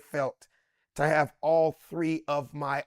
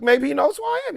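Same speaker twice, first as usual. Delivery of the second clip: hopeful; 10 semitones higher, 5 dB louder, faster than usual. Retold or imitated. Hopeful self-quote.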